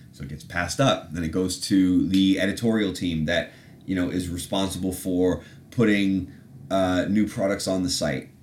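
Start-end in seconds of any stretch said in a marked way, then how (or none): none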